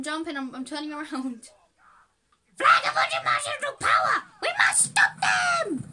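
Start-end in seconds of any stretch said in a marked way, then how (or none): 2.6-5.8 shouted, high villain battle cry; evil guy voice. Pretend. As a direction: roar it like a comic-book villain. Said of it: "that's my evil guy voice".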